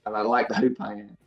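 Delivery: Texas-style Southern US accent, easy and unhurried; Southern States accent. Shown as a demonstration. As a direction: answer as a Texan from America's South. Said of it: "Southern States, America, Texas sort of style".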